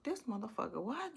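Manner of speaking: softly, to herself, baffled; half-swallowed, stunned mumble; muttered disbelief. Retold or imitated. Inner disbelief at his makeup.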